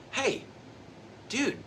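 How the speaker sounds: high, bright call of hey, dude; playful body voice. Pretend. The body calls to its owner, high and playful. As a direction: sing out hey, dude to get attention.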